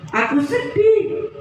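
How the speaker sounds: high, slow little sad voice; sad caterpillar. Acted. Sad caterpillar tells its friend, high and slow. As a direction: whimper it like a lonely caterpillar. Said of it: "Dia menangis dengan nyaring".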